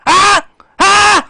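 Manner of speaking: high, piercing "huh" yelled out; screamed demand. Acted. Streamer demanding answer from Xbox boss, screamed demand.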